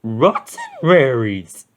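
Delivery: slow, dog-like eager voice; Scooby voice. A narrator's reading. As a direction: drool over it like a cartoon dog. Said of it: "Scooby licked his lips".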